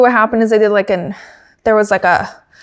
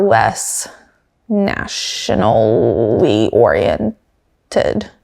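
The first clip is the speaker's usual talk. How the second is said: drawn-out wording; 10 semitones lower, much slower than usual. Real, not acted. Unsure of the wording while comparing teams, very slow. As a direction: stretch "nationally oriented" very slowly, dropping low. Searching for the right word.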